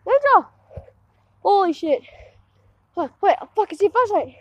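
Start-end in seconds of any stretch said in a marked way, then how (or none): 0.1-0.5 high call for a lost friend. Real, not acted. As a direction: cry the name into the dark.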